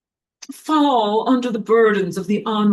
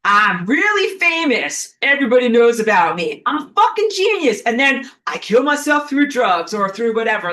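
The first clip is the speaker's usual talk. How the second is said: mock rock star bragging, voice leaping up; arrogant star; 3 semitones higher, 5 dB louder than usual. Mocking a self-destructive rock star, cocky and loud. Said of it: "normal for them to be like".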